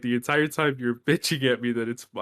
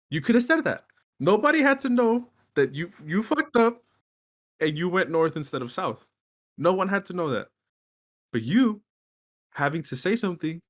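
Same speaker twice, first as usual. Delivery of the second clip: exasperated; 6 semitones higher than usual. Acted. Scolding absent dad in retelling.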